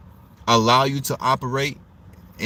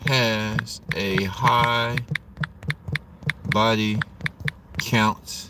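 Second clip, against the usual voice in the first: slow dictation; much slower than usual. Shown as a demonstration. Dictating the poll while typing.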